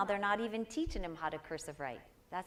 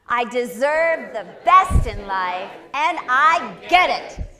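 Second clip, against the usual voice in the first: much louder, slow, passionate declaration; with feeling; 4 semitones higher, 17 dB louder, slower than usual. Shown as a demonstration. Teacher showing the class how to say it, slow passionate shout. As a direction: belt it out with full conviction. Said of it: "you're gonna say it with feeling".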